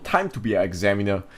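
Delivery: normal talking voice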